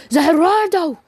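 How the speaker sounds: high, loud scream of Zeruardo; screamed attack name. Acted. Deku stops time, screaming the ability high and loud. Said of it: "he screams out".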